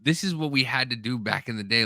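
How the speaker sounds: ordinary talk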